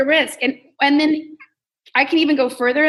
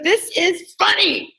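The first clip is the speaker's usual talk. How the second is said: bold, confident; 6 semitones higher, slower than usual. Retold or imitated. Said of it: "you have to be like".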